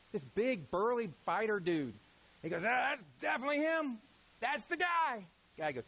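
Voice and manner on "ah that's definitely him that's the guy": higher, excited Samuel picking the king; eager prophet certain he's found him; Samuel sure of himself. Retold or imitated. Samuel eyeing the burly son, eagerly sure. Samuel voiced in Bible retelling.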